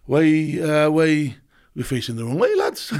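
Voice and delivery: imitating a Geordie mate; mock Geordie soldier voice; Geordie accent. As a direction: put on a singsong Geordie accent. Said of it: "he's a Geordie".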